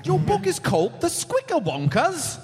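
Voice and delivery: high-pitched attempt at a Scottish accent; badly faked Scottish accent. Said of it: "Yeah, only try Scottish".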